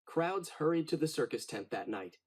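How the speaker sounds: usual speaking voice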